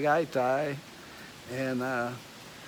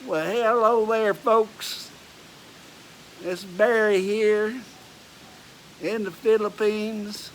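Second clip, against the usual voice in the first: Texas accent; 6 semitones higher, 4 dB louder than usual. Shown as a demonstration. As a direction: lay on a loud Texas howdy. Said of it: "give you a little of my Texas accent".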